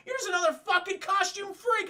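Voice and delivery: high, loud hammed-up sneer; mock yell. J J yelling at Mysterio, high mock yell. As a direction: shout the insult up high.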